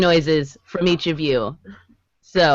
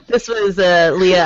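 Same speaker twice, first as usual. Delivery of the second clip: normal talking voice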